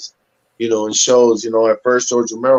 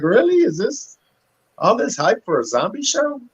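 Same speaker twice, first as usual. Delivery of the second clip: incredulous; 11 semitones higher than usual. Retold or imitated. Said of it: "and I'm like".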